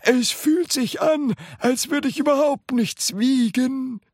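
put-on high-pitched, delighted old man's voice; calling out. A narrator's reading. Grandpa amazed at weightlessness, calling out. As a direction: marvel aloud in a high old-man voice. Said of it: "rief Grandpa Joe".

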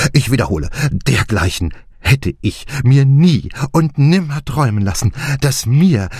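so delighted the words run out; rapturous. A narrator's reading. Clancy to the group, speechless with delight. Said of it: "vor Wonne fehlten ihm die Worte".

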